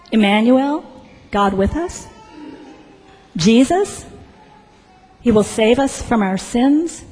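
questioning rise on each phrase; halting disbelief, each phrase lifting at the end; incredulous echo. Acted. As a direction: repeat it back as doubtful questions. Registrar echoes names back in disbelief.

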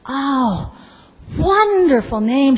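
a touch of high, lilting delight; acted admiring approval; mild gushing approval. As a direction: approve warmly, a little gushing. Civil servant approves of the name.

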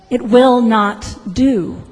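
loud, slow, word-by-word refusal; emphatic official refusal, slow and loud; firm disapproval. Acted. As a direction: refuse with finality, stressing each word. Registrar's final refusal, echoing the clerk.

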